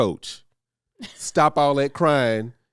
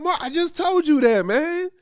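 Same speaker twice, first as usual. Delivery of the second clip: much higher, incredulous scolding; exasperated dad. Retold or imitated. Dad scolding his son after the ball hit, high exasperated voice. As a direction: throw up your hands at the kid. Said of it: "I'm like".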